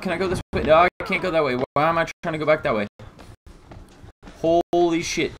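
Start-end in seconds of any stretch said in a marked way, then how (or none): none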